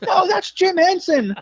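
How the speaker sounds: very high, drawn-out imitated protest; exasperated correction. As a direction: wail the correction like a despairing interviewer. Exasperated interviewer correcting him.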